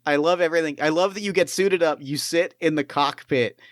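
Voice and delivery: ordinary talk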